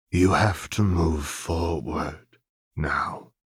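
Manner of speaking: slow, gentle fatherly voice; a hint of a tender father, unhurried; lightly voiced Silco.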